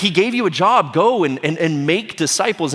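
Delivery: urgent prodding. Acted. Angels prod the idle disciples.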